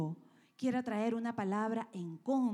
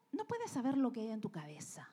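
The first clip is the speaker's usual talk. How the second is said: whisper; 4 dB quieter, faster than usual. Real, not acted. Sermon, sudden whisper.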